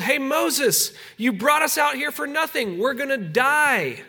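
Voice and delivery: high, desperate whine, slowing on "gonna die"; grumbling Israelites. Retold or imitated. Preacher voicing the people moaning to Moses that they'll die, high and desperate. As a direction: moan that you're doomed, pleading upward. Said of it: "And they grumbled".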